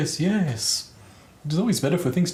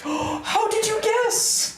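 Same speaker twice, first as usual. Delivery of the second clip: high, slow hammed-up surprise; mock gasp; much slower than usual. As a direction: gasp then feign shock.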